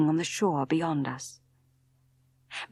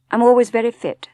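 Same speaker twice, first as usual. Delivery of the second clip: short and louder, cutting it off; husband shutting down talk of his health, curt and louder; 5 semitones higher, 9 dB louder than usual. Acted. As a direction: clip the reply, a touch louder. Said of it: "said Maxim shortly".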